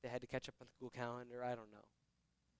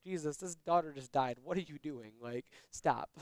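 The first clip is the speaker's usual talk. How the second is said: louder, half-laughing objection; mock protest from disciples; 2 semitones higher, 7 dB louder than usual. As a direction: playfully tell him off in disbelief. Imagined disciples scolding Jesus, laughing.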